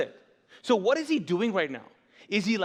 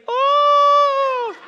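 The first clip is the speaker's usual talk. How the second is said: a huge high "Oh!" of amazement; amazed shout; 10 dB louder than usual. Shown as a demonstration. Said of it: "Which literally translates".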